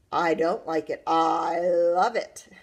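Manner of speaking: loud boast, drawn out at the end; announcing triumphantly. A narrator's reading. Brother winning the rhubarb contest, announcing it. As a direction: stretch out the gloating punchline loudly. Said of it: "he announced".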